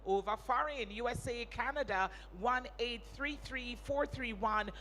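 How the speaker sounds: loud, driving appeal read; telethon appeal. Acted. Host urging viewers to pledge to the telethon, loud announcer appeal. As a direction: push the viewers to call and pledge now.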